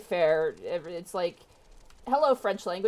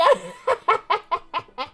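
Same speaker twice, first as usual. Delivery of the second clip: high cackling granny laugh; old lady cackle; 12 semitones higher, 6 dB louder than usual. Acted. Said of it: "my old lady voice".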